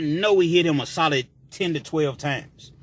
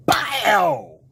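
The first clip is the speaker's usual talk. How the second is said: sharp imitated punch impact, higher and louder; punch sound; 6 semitones higher, 4 dB louder than usual. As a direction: smack it out like a fist landing.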